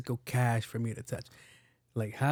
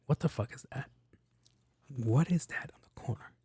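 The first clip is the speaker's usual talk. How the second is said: hushed whisper; 5 dB quieter than usual. Retold or imitated. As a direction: drop to a whisper, incredulous. Spotting something odd on screen.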